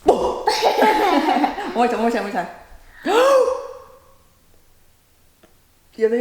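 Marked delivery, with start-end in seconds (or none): 0.1-2.5 slow, laughing through the words; giggly, drawn-out coaxing. Real, not acted. Coaching child through hiccups. 3.0-3.7 sharp high gasp of breath; demo gasp. Shown as a demonstration. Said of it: "Hold your breath like this".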